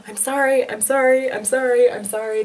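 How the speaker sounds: high, loud, urgent mock apologies; repeated apologizing. Doctor apologizing to whimpering patient, urgently apologetic. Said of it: "just apologizing".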